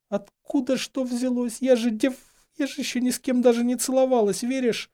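very high, agitated, speeding up; distraught pleading. A narrator's reading. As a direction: plead to be believed, tumbling into haste. Girl distraught, justifying herself. (взволнованно оправдываясь высоким голосом)

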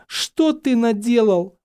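slow, high, loud dismay; horrified outcry. A narrator's reading. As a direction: cry out aghast, drawing it out. Hermione horrified at Harry's deed.

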